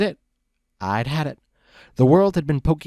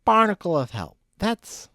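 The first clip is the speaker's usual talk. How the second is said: put-on high, sloppy drunk pony voice, much higher; drunk Loose Leaf; 10 semitones higher, 5 dB louder than usual. Drunk buddy lecturing Brick on the road, high slurry drunk voice. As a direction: ramble like a happy drunk friend.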